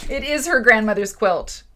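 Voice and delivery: loud, laughing exclamation; laughing delight. Real, not acted. Host shows off the grandmother's quilt to viewers, laughing delight.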